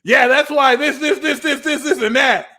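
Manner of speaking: high, loud breezy chatter; casual Cancer. Retold or imitated. Cancer returning to a conversation, high and loud. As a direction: toss it off high and loud like nothing happened. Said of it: "you go back to the conversation".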